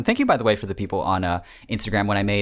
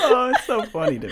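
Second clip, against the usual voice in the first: laughing; 13 semitones higher, 3 dB louder than usual. Real, not acted. Giggling after joke.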